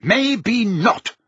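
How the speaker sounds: high-pitched, defensive concession; heated retort. A narrator's reading. Prime Minister arguing back.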